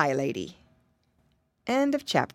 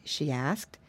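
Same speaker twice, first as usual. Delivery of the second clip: ordinary delivery, nothing marked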